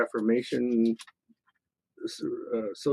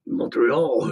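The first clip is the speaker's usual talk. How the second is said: playful stab at a Canadian French accent. Shown as a demonstration. Host showing off Montreal, Canadian French accent. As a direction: put on Canadian French for one word. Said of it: "I can do the Canadian French".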